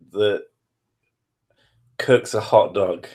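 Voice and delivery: usual speaking voice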